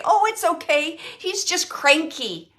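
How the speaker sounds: high, loud, breezy mock excuse; acted-out cheery dismissal; mock reassurance. Voicing a child excusing a cranky man.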